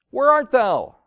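loud, high call. Acted. God calls to Adam in the garden, calling out loudly. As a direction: call out as if searching for someone.

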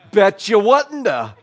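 loud, high, slow sarcastic jab; mock them with exaggerated agreement; snapping back at the woman who judged the family. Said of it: "you just wanna be so sarcastic".

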